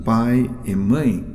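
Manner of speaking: ordinary talk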